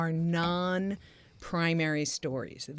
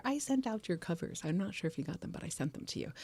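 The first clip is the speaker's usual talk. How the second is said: soft aside; 10 dB quieter than usual. Real, not acted. Fading aside to J R.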